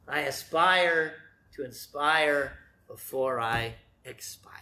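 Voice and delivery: drawn out, almost reverent; hushed slow rhyme; slow slogan. Shown as a demonstration. Slogan repeated in full.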